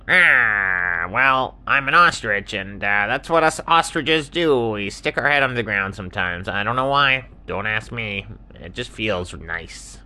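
put-on ostrich voice, swooping up then settling; easygoing ostrich, explaining. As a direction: explain it with a shrugging ease.

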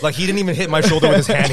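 ordinary delivery

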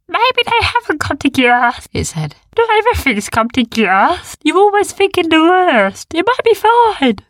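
high, loud, soothing little creature; calm the worried ones; littlest beast. A narrator's reading. The littlest beast reassures the others, high. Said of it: "the littlest one speak".

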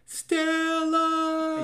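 stretched, very slow high yell of Stella; Stella bellow. Shown as a demonstration. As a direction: howl Stella like Brando in the street. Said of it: "reference to the play in the movie".

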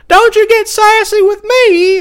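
very high, loud mock scolding girl voice; scolding neighbor girl. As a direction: shriek a scolding at him in a put-on girl's voice. Neighbor girl scolds him again.

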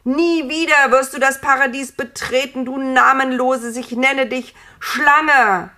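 loud, high-pitched furious bellow; enraged roar. A narrator's reading. God banishing the snake from Paradise, roaring with rage. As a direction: roar the banishment at full voice, beside yourself. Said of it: "Gott brüllte, ganz außer sich vor Wut".

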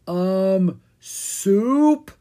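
a hesitant zombie guess; zombie groan. A narrator's reading. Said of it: "Owen groaned".

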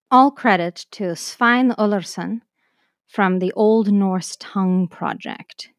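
normal talking voice

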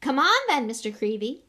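loud, high-pitched hearty coaxing; booming celebrity bonhomie; jovial showman. Acted. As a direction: chivvy him along cheerfully. Lockhart jovially organizing a photo.

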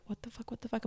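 very quiet, barely voiced puzzlement; inner thought. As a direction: think it under your breath, baffled. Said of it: "in my head".

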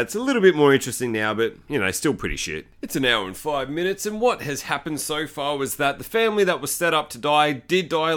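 ordinary talk, nothing marked

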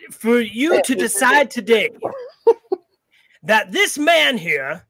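ordinary talk, nothing marked

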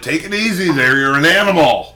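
high, loud mock scolding; hammed-up indignant rebuke; bitching. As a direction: scold the tow guy like he's wild. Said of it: "And then I'm bitching".

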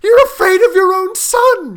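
high, loud, desperate accusation; frantic mother's shrill outburst; accusing cry. A narrator's reading. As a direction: cry out while fighting free. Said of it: "she cried, struggling".

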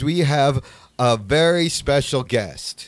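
slow, with weight on very; slow dramatic build-up. Real, not acted. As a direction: draw it out for suspense. Building up the guest reveal.

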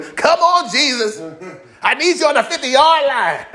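mock pleading fan. Fan begging Jesus for help at the game, mock pleading. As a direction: plead like a desperate football fan.

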